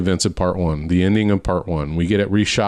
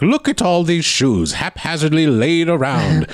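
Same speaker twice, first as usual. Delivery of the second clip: a hint of a fussy leprechaun; 5 semitones higher than usual. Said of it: "he's like".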